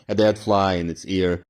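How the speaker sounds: plain reading voice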